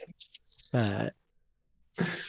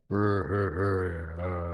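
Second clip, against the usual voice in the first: yeti growl; 3 semitones lower, much slower than usual. Acted. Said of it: "looks at you and goes".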